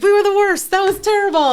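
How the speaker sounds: high, loud and celebratory; cheering on your own flop; gleeful shout. Retold or imitated. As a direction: proudly bellow about being the worst. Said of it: "And like".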